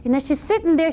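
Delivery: ordinary delivery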